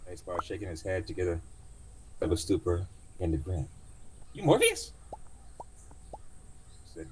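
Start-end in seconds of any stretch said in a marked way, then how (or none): 4.3-4.9 very high incredulous joke; surprised passenger. Acted. Passenger joking to the driver, high disbelieving voice. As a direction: toss it off as a cheeky question.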